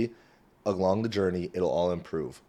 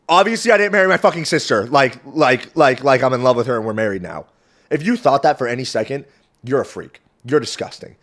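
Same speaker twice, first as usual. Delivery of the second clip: indignant outburst; 3 semitones higher, 7 dB louder than usual. Real, not acted. Vlogger scolding viewers who took the joke literally, loud and outraged. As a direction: scold anyone who believed it.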